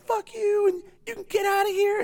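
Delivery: very high mock singer voice. Podcast guest imitating an emo singer brushing off an ex, high-voiced impression. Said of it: "that's my [name] impression".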